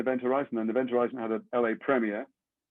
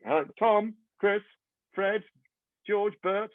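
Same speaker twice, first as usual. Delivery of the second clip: high, uncertain calling down the line; mock phone roll call; 9 semitones higher, slower than usual. Calling into a silent conference line, high and unsure. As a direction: check who's still on the call.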